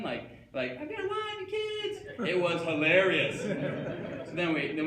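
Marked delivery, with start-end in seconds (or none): 0.7-2.0 very high wailing plea; hammed-up tearful falsetto begging; screaming and crying. Mall guard begging the crazed driver, high wailing scream. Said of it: "screaming and crying".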